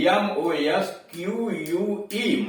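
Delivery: letters of एमओएसक्यूयूई read one by one, slow; dictating the spelling; spelled out slowly. Shown as a demonstration. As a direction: spell it out carefully. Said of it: "जैसे".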